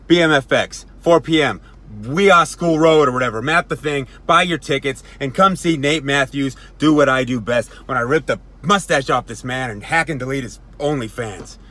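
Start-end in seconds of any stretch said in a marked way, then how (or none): 0.1-10.6 punchy, loud wrestling hype; loud promo plug. Acted. Wrestler telling fans to buy tickets, loud promo plug. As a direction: announce it at full volume.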